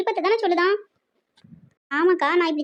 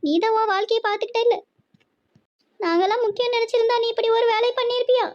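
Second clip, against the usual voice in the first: high-pitched, weepy scolding; hurt mother crying in a raised pitch; tearful reproach; 5 semitones higher than usual. Acted. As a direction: reproach your son through tears. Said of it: "நான் அழுதா உனக்கு என்ன".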